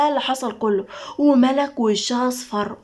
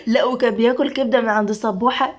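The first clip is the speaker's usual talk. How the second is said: laughing. Acted. Two friends joke about Silver, laughing. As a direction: laugh while telling it.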